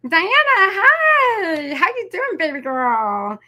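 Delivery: high, drawn-out sing-song hello; excited greeting. Real, not acted. Crafter greeting viewer Diana in chat, high excited sing-song. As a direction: greet a favourite viewer with delight.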